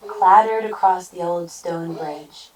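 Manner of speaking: ordinary delivery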